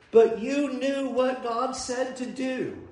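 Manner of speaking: slow, soft, measured rebuke in a lightly changed voice; a hint of God gently reminding; God's rebuke. Preacher voicing God to the congregation, soft and slow.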